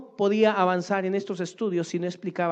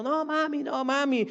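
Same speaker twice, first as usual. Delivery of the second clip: put-on child crying out; 11 semitones higher than usual. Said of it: "el pequeño gritando".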